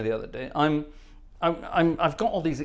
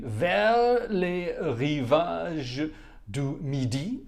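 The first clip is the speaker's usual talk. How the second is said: slow, deliberate French, syllable by syllable; halting French read-aloud, struggling for the rhythm; much slower than usual. Real, not acted. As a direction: pick carefully through French lyrics, drawn out. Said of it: "I can't do it in rhythm".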